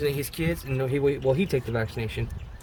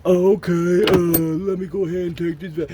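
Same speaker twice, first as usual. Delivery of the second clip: gullible yes-man; 2 semitones higher, 3 dB louder than usual. Retold or imitated. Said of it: "just be like".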